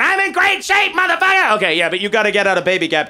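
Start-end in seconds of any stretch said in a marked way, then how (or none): 0.0-1.5 high, loud bragging shout; mock boast. Comic playing himself, bragging at the mall in a half shirt. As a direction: crow it at the mall crowd.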